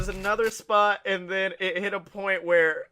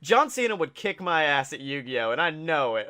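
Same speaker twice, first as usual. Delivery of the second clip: ordinary delivery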